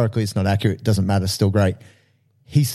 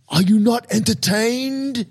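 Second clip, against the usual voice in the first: high, slow defiant challenge; imitating Maximus; 11 semitones higher, slower than usual. Gladiator challenging the arena crowd, high and slow. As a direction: throw the line at the crowd like a gladiator. Quoting the famous Gladiator line.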